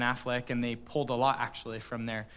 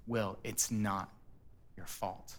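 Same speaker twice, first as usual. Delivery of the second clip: slow tender reassurance; 5 semitones lower, 10 dB quieter than usual. Retold or imitated. Therapist steps closer again.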